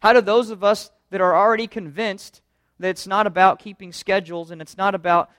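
ordinary delivery

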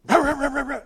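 high, loud mock rant; preacher imitating someone blowing up; mock angry ranting. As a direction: ham up a wordless angry outburst. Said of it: "and you're angry".

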